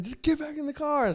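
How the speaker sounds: high-pitched yelled order without the volume; mock yell. Officer repeating his order to the driver, retold mock yell. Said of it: "he just starts yelling".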